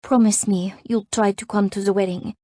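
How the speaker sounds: put-on gently insistent French-accented request; pleading French friend. A narrator's reading. Friend urging her to come to the wedding, pleading.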